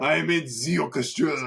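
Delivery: put-on grand French accent, proud musician. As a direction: put on a French orchestra snob. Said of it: "he's gotta be French".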